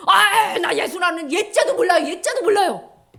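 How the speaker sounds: high, loud flustered denial; panicked denial. Acted. Peter afraid of being caught, panicked denial. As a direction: deny it frantically. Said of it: "자기가 잡혀갈까 봐".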